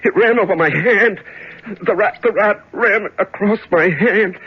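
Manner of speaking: high, startled recoil; horrified shock. Acted. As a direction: gasp it out in disgust and fear. Rat runs over his hand.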